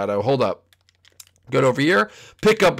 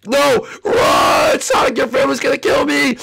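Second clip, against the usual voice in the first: high, loud screams for help; frantic cartoon panic; panicked shouting; 14 semitones higher, 6 dB louder than usual. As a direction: yell in terror while fleeing. Junior flees Shadow.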